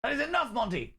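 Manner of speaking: high-pitched comic telling-off; mock exasperation. Streamer reining in Monty, mock exasperation. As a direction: plead in a high voice for it to stop.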